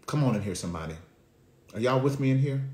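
ordinary talk

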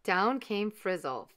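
plain reading voice

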